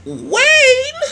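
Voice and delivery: high, drawn-out shout; calling out loudly. Acted. Woman calling Wayne mid-quarrel, shrieked loud and long. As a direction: holler the name for backup. Acted call for someone.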